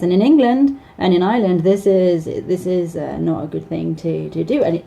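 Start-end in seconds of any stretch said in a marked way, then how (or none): none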